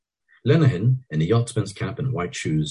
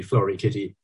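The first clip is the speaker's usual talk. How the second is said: ordinary delivery, nothing marked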